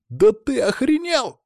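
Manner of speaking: very high, stretched-out exclamation; outraged disbelief. Acted. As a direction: squawk in outrage, slow and high. Lyokha outraged at the plan.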